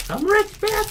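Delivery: high squeaky mock boast; high mock boast at his own wealth; squeaky high Chappelle. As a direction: pitch way up for the punchline. Quoting Chappelle, sudden high squeak.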